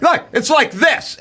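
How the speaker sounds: high, know-it-all demonstrating tone; condescending helper. Retold or imitated. Companion showing how the kiosk works, high condescending voice. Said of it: "And they go".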